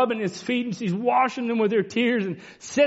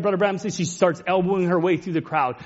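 ordinary talk, nothing marked

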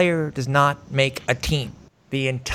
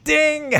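bright high ding like a bell; idea ding. Pretend. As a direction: chime it out like a quiz bell, pitched way up. Remembers Spotify, ding of success.

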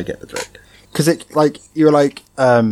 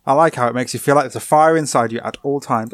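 normal talking voice

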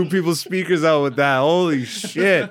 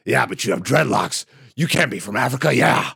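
blustering boss impression, building to a shout; Vince McMahon. Podcaster as promoter ordering wrestler around, blustering impression. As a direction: imitate a domineering promoter laying down orders. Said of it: "deal with Vince McMahon antics".

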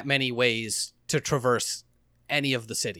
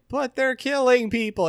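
higher-pitched whiny protest, imitating a soldier; mock whine; 9 semitones higher than usual. Soldier protesting the killing to a veteran, mock whine. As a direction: whine it like a naive recruit. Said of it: "Mikhail whines at him".

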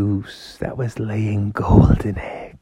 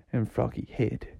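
plain reading voice

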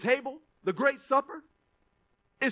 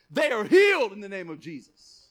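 bold declaration; 9 dB louder than usual. Shown as a demonstration. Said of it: "you say".

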